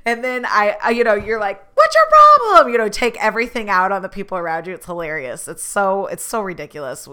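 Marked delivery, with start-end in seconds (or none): 1.8-2.7 high, loud mock-angry yell; mock snap. Worn-out driver to a stranger: loud high mock snap. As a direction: blow up at a stranger, hammed up. Snapping when cranky.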